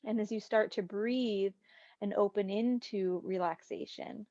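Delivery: calm meditation voice, long pauses between phrases; guided meditation. Real, not acted. Meditation teacher guides class into breathing, calm and unhurried. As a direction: leave room for breath after each phrase.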